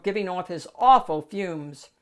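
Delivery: ordinary delivery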